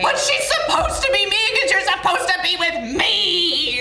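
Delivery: high, loud, hammed-up wail; mock hysterical mother. Mother objects to son defending his fiancée, mock hysterical wail. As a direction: wail it possessively, building to a stretched last word. Possessive mother's outburst acted out.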